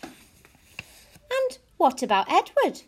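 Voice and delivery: slow, hesitant question, pitched high; very uncertain. A narrator's reading. Girl asking her parents about her rabbit, very uncertain. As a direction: ask it haltingly, unsure of the answer. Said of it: "her voice very uncertain".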